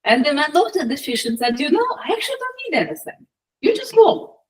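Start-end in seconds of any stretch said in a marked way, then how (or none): 1.6-4.4 high, quick, easygoing brush-off; light, high-pitched dismissal; casual refusal. Acted. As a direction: wave the offer away in a raised voice. Old man turns down fish's offer.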